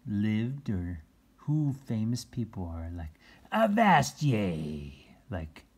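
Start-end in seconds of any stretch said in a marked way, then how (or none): 3.5-5.0 slow, high pirate growl of a greeting; pirate cry. Shown as a demonstration. Reader shows kids a pirate greeting. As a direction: bellow it like a pirate.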